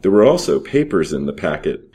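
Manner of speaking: ordinary delivery, nothing marked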